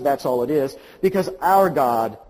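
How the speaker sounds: ordinary talk, nothing marked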